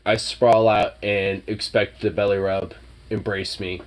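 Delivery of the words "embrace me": soft lazy cat voice; cat's voice. Retold or imitated. The cat asking for a belly rub, soft. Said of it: "he would just be like".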